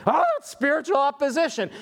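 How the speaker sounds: high-pitched, overdone lament; mock complaint. Late churchgoer blames a speeding ticket on opposition, mock complaint. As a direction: wail it like a self-pitying excuse.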